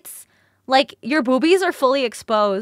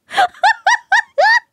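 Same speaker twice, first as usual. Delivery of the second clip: very high, loud laughing fit; shrieking laugh; 7 dB louder than usual. Real, not acted. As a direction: lose it completely laughing. Laughing hard at the story.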